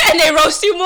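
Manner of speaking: loud, high, through laughter; real laughing shout. Real, not acted. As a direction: shout it out while cracking up. Cracking up at friend's story.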